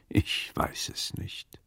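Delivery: a slow, hushed whisper of doubt. Acted. Brooding over the magistrate, to himself: slow whisper. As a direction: breathe it out, barely voiced and unsure.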